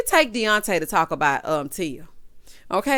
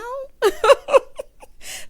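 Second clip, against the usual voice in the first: loud, high burst of laughter; big laugh; 7 dB louder than usual. Real, not acted. Host laughing at girlfriend jumping the gun, big laugh. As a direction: crack up at your own joke.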